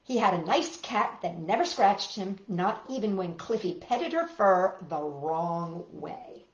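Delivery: ordinary delivery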